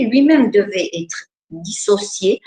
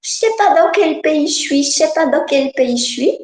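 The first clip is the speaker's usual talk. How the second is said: put-on higher childlike voice, lost; a small girl who doesn't know where she is; little girl voice; 7 semitones higher than usual. Little girl answering the husband, lost, childlike voice. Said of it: "c'est la petite fille qui parle".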